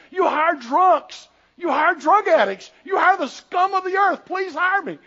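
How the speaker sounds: loud, high acted-out protest; desperate begging. Rejected pastor begging mill boss for work, loudly desperate. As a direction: plead at the top of your voice.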